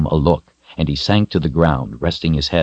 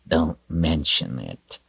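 growled; 8 dB quieter, slower than usual. A narrator's reading. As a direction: growl it out like a wolf. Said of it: "he growled".

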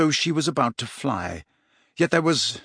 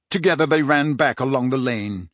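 ordinary delivery, nothing marked

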